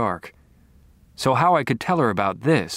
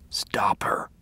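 whispering; 2 semitones lower, 4 dB quieter than usual. Acted. Dying Harry gives an order.

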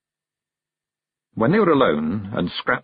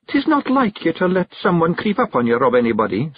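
higher-pitched Scots wife, chiding; Jeanie, Scots wife; 8 semitones higher than usual. A narrator's reading. Jeanie chides her husband Rob for being unwary, chiding.

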